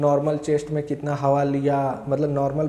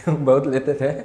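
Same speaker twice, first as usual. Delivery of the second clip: laughing; 5 dB louder than usual. Real, not acted. Teasing a senior friend.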